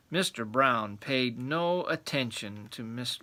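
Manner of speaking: plain reading voice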